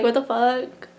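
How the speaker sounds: ordinary talk, nothing marked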